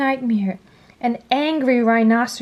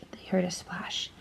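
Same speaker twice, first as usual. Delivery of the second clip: whisper; 3 semitones lower, 12 dB quieter than usual. A narrator's reading. Narration whispered.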